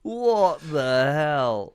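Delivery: long, incredulous outburst; exasperated disbelief. Real, not acted. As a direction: drag out each word in disbelief. Exaggerated disbelief at the plot.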